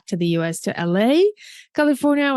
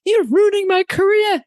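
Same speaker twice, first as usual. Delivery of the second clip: retold teenage outburst, much higher and louder; teen protest; 12 semitones higher, 5 dB louder than usual. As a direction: cry out at your parents like a fed-up fifteen-year-old. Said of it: "I think I told my parents".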